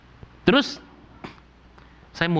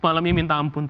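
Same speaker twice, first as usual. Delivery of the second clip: normal talking voice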